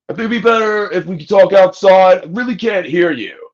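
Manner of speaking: lightly voiced bouncer, coaxing; mock polite bouncer.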